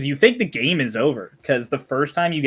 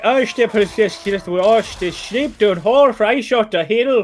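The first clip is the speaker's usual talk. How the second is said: high, near-unintelligible Southern Irish babble; thick Southern Irish accent; 8 semitones higher, 5 dB louder than usual. Retold or imitated. Imitating a clip of a man whose sheep got stolen, thick Southern Irish. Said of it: "it literally just sounds like he's like".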